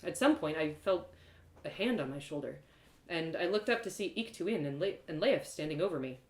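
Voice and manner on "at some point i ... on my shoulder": usual speaking voice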